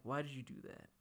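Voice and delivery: soft, subdued mimicry; hushed retold question; imitated quiet hurt. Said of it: "He's so quiet".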